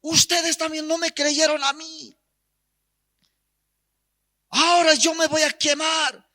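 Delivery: high, strained acted shout; desperate defiant cry, pitched high. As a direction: yell it out to those inside. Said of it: "y gritó".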